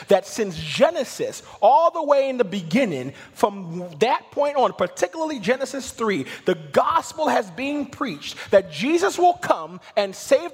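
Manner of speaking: loud, high, sustained proclamation; full-force preaching. Real, not acted. Preacher proclaims the long-awaited Messiah, full-force preaching.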